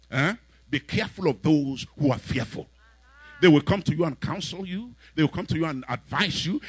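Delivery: usual speaking voice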